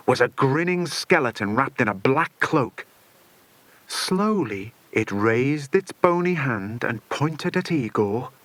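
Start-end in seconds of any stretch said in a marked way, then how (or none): none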